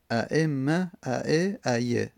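ordinary talk, nothing marked

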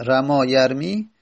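ordinary talk, nothing marked

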